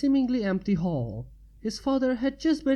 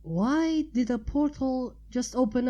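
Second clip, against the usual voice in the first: high curious Pinky voice; 5 semitones higher than usual. A narrator's reading.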